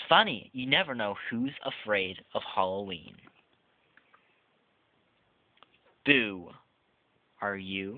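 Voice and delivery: lively, sing-song storybook read-aloud; reading to children. Acted. Reviewer reading the book Boo aloud to viewers, playful storybook voice. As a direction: read it like a Halloween picture book to little kids.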